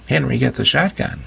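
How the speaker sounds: lightly mocking the frightened woman; mock shriek. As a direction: quote her yell, wryly. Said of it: "my life was".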